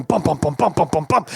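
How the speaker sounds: imitated racing heart in rhythmic bums; pounding heartbeat. Storyteller mimics a viewer's heart, pounding heartbeat. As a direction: pulse it out like a thumping heart. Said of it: "your heart just starts going".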